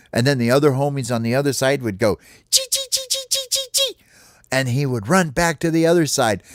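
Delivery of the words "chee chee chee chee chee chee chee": very high, sharp little squeaks; rapid-fire taunting chirps; critter chittering. Acted. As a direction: squeak teasingly like a tiny rodent. Other homies taunt the cat.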